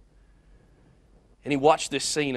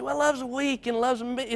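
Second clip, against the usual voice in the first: higher, lightly mocking the weak view of love; mocking quote; 10 semitones higher than usual. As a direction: toss off the naysayer's line in a mock voice. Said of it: "let's not look at love and say".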